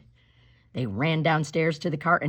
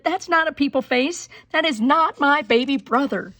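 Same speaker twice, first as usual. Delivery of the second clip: high, louder protest; indignant child; 9 semitones higher, 6 dB louder than usual. Acted. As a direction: insist it's not her brother. Kristen protests the baby.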